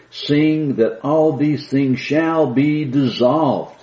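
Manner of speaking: slow solemn proclamation; slow scripture reading. Acted. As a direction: stretch the verse out with solemn weight. Preacher rereads the verse.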